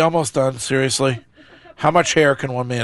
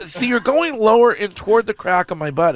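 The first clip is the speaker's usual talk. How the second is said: alarmed protest; 8 semitones higher than usual. Real, not acted. Protests waxing too low, in pain.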